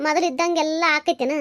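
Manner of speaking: ordinary talk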